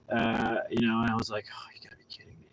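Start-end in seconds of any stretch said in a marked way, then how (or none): 1.5-2.5 breathy whisper of disbelief. Retold or imitated. Driver whispering in disbelief at being stopped, whispered. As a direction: barely voice it, half to yourself.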